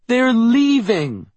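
put-on high, loud, drawn-out child's cry; slow, piercingly high near-shout. A narrator's reading. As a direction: wail it out like an upset little girl. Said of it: "Meredith nearly shouts".

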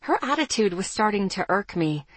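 ordinary delivery, nothing marked

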